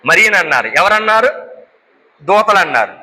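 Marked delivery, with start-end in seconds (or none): none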